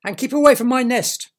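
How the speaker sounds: loud high squawking warning; territorial bird. Acted. Bird warns Derek off its worms, loud squawk.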